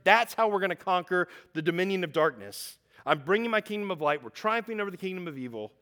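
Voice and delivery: loud, high, driving pulpit voice; preaching at full force. Acted. Pastor driving home the gospel to his congregation, preaching at full force. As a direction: preach it out hard to the room.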